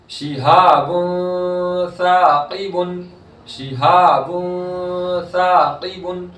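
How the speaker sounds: slow, drawn-out Quranic chanting; tajweed recitation. Shown as a demonstration. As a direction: stretch the vowels in recitation. Recites example.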